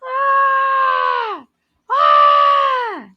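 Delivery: long high held "ah"; sustained high-pitched mock scream. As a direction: imitate someone screaming on and on. Imitating hour of screaming.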